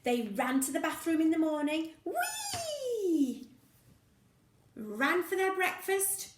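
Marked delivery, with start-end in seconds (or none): 2.0-3.5 squealing whee, way up high; a joyful squeal of speed; excited child's whee; high gleeful whee. Acted.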